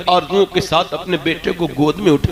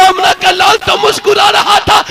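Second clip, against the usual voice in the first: high, loud repeat of आमना का लाल तो मुस्कुरा रहा था; same line called out high and loud to the crowd; preached shout; 13 semitones higher, 5 dB louder than usual. Shown as a demonstration. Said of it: "repeated, then shouted".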